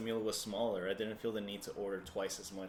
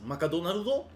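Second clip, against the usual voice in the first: put-on higher, louder Japanese-accented Macadonaldo; 8 semitones higher, 7 dB louder than usual. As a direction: mimic a Japanese friend saying Macadonaldo. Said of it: "he says".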